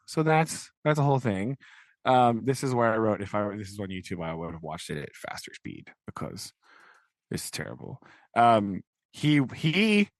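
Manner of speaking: ordinary delivery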